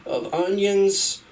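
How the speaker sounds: high, sweet, polite customer voice; overly polite customer. Acted. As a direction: ask nicely, like a dressed-up customer.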